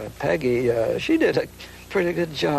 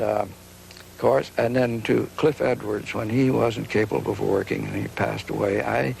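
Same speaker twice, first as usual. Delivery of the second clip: ordinary talk, nothing marked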